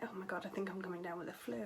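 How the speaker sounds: ordinary talk, nothing marked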